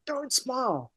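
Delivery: high mock-stern don't smile; hammed-up stern warning. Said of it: "And she's like".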